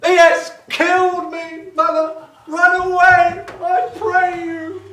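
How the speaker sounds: high, loud melodramatic dying cry; hammy stage death. Acted. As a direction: wail it like a ham Shakespearean actor. Hammed Shakespeare dying line.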